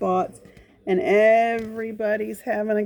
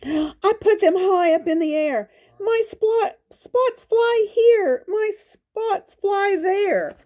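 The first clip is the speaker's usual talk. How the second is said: higher, rising excited storybook leopard; 11 semitones higher than usual. Acted. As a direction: lift the voice up as the spots fly. Leopard makes spots fly.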